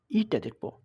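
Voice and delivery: ordinary delivery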